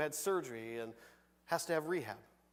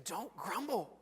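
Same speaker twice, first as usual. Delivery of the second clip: mock warning; 3 semitones higher, 4 dB quieter, much slower than usual. Voices James's warning, playfully drawn out.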